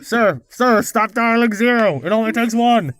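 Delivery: a fed-up operator correcting the caller; exasperated operator. Retold or imitated. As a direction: operator telling prank caller to stop, exasperated.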